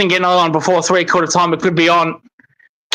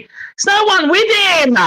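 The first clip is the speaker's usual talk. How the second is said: high, slow, stretched outburst of disbelief; exasperated yell; 11 semitones higher, slower than usual. Real, not acted. Fan at the screen, nobody marking, high exasperated yell. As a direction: cry out at the open forward.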